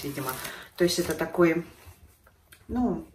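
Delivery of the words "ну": usual speaking voice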